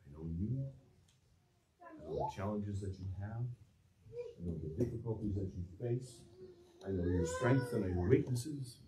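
soft, close reassurance; tender voice of God. Acted. Preacher voices God comforting worried listeners, soft and tender. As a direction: speak it as a gentle promise to a worried child. God's promise voiced to congregation.

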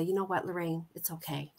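hushed, comforting "it's okay"; soothing social worker. Retold or imitated. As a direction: social worker consoling a child, quiet and soothing. Social worker soothing young Lorraine.

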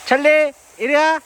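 high calling, coaxing the dog; talking to a dog. Real, not acted. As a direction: coax the dog over, high.

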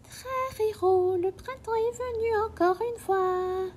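put-on high, excited children's cry; children cheering that spring has come; children shouting. A narrator's reading. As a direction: cheer it like delighted kids. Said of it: "et crièrent".

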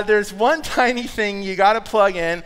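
usual speaking voice

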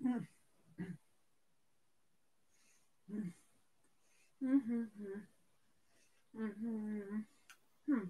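humming through a mouthful of food; chewing, answering with mouth full. Real, not acted. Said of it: "Can't talk with my mouth full".

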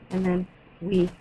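normal talking voice

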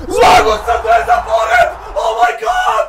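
high ecstatic shouting; excited screaming. Real, not acted. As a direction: scream with joy. Celebrating second win.

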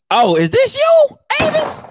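shocked at the photo; mock disbelief. Real, not acted. Roasting next photo.

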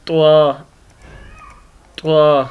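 त्वा spoken heavy, tongue tip at the root of the upper teeth; Arabic ط pronounced slowly; heavy letter demo. Shown as a demonstration. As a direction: model त्वा clearly for pupils. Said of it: "पढ़ के देख लें".

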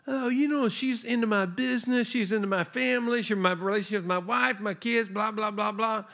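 high, whiny mimicry of a grumbling son; mocking whiny complaint. Pastor mimicking a man bashing his mother, high and whiny. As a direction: ham up a grown son griping about his mom. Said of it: "lets into his mom".

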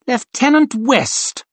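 high, slow, drawn-out summons; showy officer's ringing call. A narrator's reading. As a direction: call his name across the crowd. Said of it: "he called".

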